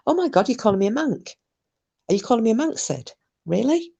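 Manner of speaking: high-pitched pretend indignation; teasing, incredulous rising questions; mock offence. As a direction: act affronted, playfully challenging a friend. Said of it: "I'm only kidding".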